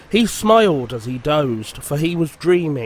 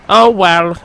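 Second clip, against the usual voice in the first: louder, sulky sigh; 3 semitones higher, 7 dB louder than usual. A narrator's reading. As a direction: give in with a sulk. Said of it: "said Sir Handel sulkily".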